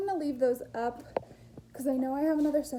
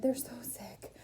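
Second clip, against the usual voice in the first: whisper; 5 semitones lower, 6 dB quieter than usual. Real, not acted. Sick kids asleep.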